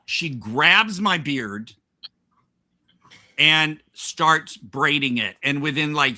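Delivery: ordinary delivery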